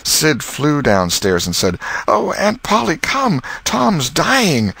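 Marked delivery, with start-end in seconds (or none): 2.0-4.7 high, urgent cry for help; a frightened boy calling out; alarmed boy. Acted. Sid calling his aunt in alarm, high and urgent.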